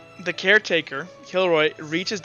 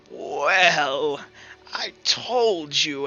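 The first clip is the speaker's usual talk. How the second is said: slow, drawn-out possessed reply; voice beginning to muddle; 2 semitones lower, much slower than usual. Acted. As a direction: stretch each word as another voice creeps in. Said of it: "his voice kinda begins to muddle".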